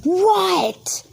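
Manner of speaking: high startled yelp of a question; imitating John's sudden shock; shocked what. Stopping mid-fight, John turns in high shock. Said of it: "John literally stops and goes".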